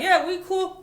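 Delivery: very high, loud placating reply; mock reassurance. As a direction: act out brushing off the boss in a high voice. Retold reassurance to angry boss.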